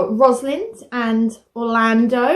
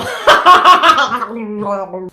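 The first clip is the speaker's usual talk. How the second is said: evil laugh; 4 semitones lower, 4 dB louder than usual. Acted. After menacing puppet.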